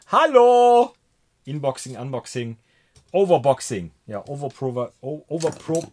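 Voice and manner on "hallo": high, loud hello; high-pitched, booming greeting; loud call-out. Real, not acted. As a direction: holler it out brightly pitched. Calling out loudly to chat.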